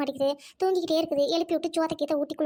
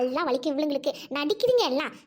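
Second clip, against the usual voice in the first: ordinary talk, nothing marked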